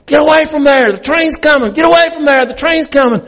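high, loud repeated yell of warning; a father yelling frantically as the train nears; hollering warning. Retold or imitated. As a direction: holler it across to the boy to get clear. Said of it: "He starts hollering".

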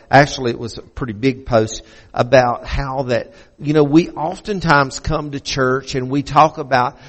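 normal talking voice

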